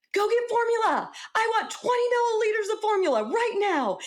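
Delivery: very high, urgent demand; yelling orders. Retold or imitated. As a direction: bark it at the nurses like an order. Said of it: "I literally yelled to the nurses".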